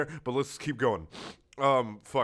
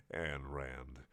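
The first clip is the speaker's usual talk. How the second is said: normal talking voice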